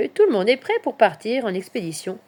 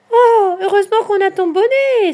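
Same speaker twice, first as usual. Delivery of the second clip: high, loud relieved exclamation; exclaiming; 12 semitones higher, 11 dB louder than usual. A narrator's reading. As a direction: burst out in relief, voice way up. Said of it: "s'exclament ses amis". (exclamation soulagée, aiguë et forte)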